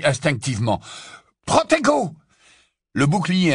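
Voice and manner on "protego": high, loud spell shout; incantation criée. Acted. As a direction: cast a shield spell urgently. Harry lance un sort de bouclier. (sort lancé dans un cri)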